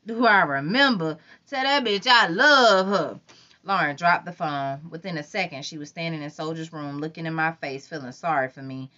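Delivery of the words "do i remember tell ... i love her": louder, slow boastful crow; loud slow gloating; Tony's voice. A narrator's reading. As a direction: gloat to the crew.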